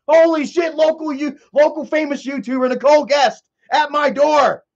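excited neighbor. Retold or imitated. Neighbor voiced answering the door.

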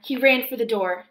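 plain reading voice

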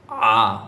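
"আ" squeezed in the throat, low; low, throat-constricted "আ"; pressed from the throat. Shown as a demonstration. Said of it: "গলায় চাপ দিয়ে বলতে হবে".

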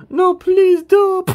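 very high, loud squeaky plea; drawn-out begging in a tiny high-pitched voice; Chip. Acted. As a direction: plead loudly, way up in falsetto. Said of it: "in his Chip voice".

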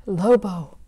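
sharp gasp, then the name hushed; gasp of surprise. Acted. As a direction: catch your breath at the shock.

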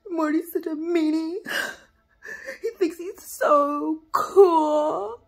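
whimpering sob-talk, dragged out and wounded; fake goblin crying. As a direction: blubber like a beaten little goblin. Goblin whining over a beating.

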